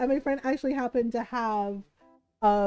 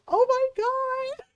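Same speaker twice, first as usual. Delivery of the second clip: excited squeal; 13 semitones higher, 4 dB louder, slower than usual. Retold or imitated. Fan squeals seeing voice actor.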